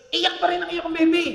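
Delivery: normal talking voice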